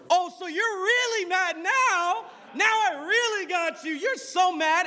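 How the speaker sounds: high-pitched, drawn-out goading; hammed-up, high taunting. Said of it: "No, she gets louder".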